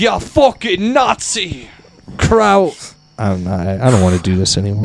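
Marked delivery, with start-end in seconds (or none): 0.0-2.9 higher, slow mock Irish jeer; mock Irish taunt, slow and high; mock McGregor. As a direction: sneer it like a hammed-up McGregor. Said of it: "He was like".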